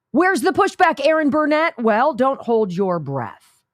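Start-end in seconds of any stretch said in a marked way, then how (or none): none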